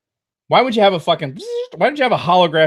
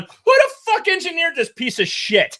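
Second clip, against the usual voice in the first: high, incredulous mock rant; exasperated mechanic; 13 semitones higher than usual. Mechanic in the back scoffing at the ship, pitched-up mock outrage. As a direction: play a fed-up mechanic scoffing at the design. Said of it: "I'd be sitting in the back going".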